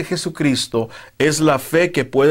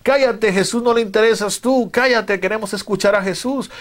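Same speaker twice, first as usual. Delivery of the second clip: higher-pitched scolding crowd, not shouted; mock telling-off in a raised pitch; retold hushing; 7 semitones higher than usual. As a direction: voice an impatient crowd hushing someone, high-pitched. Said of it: "comenzó a callarle y decirle".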